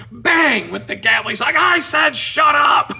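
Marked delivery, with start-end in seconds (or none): 0.1-0.7 loud, high punched word; sudden loud imitated bang; gavel slam. 1.6-2.9 slow, high, very loud yell; imitated enraged judge shouting; furious judge. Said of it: "he gets mad at the guy".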